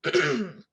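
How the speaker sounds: loud rough throat clear; smoker's cough. Real, not acted. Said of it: "I'm clearing my throat a lot".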